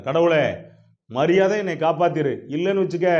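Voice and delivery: loud, high warning; commanding threat. Acted. Satan warns God to save him, loud and commanding. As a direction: press the threat forcefully.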